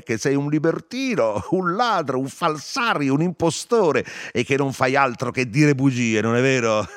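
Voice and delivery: high, mocking tirade broken by laughs; laughing taunt. A narrator's reading. Narrator shakes Stefano by the collar, laughing. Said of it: "lo scrollai ridendo".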